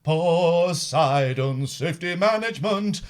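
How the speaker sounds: slow, deliberate ad read; slow radio sponsor announcement. Acted. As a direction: announce each sponsor slowly. Reads next sponsor.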